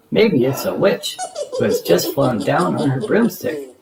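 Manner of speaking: usual speaking voice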